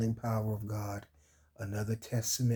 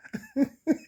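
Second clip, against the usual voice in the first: high, strained laugh that hurts; real pained high laughter; painful laugh. Real, not acted. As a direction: laugh helplessly despite the pain. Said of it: "it hurts to laugh".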